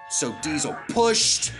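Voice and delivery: slow, with PUSHED leaned on hard and loud; pushed drawn out and punched; effortful stress. A narrator's reading. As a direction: heave on the word pushed, stretching it. Narrator acts out the straining effort.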